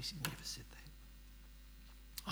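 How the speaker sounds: unvoiced whisper; whispered confidence; whispered aside. Real, not acted. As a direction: breathe it as a secret. Hushed aside about wife.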